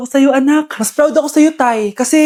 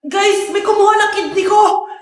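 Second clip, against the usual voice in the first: comic shout; 5 semitones higher than usual. Acted. Calling out to everyone.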